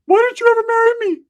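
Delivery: a silly high imitation of Ricky hitting on her; mocking Ricky. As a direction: whine it way up high like a lovesick fool. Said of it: "Ricky's on her".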